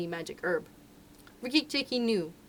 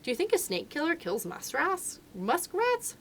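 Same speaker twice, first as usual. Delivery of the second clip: lightly higher, amused rising question; a hint of mongoose; 8 semitones higher, slower than usual. A narrator's reading. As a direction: tease the frightened muskrat. Mongoose answers muskrat.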